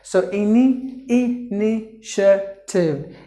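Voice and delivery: "initiative" slow, middle TI said as sh. Shown as a demonstration. Teacher showing students the sh in "initiative", slowly. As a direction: draw out "initiative" with a clear sh. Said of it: "The T A should be pronounced like a sh".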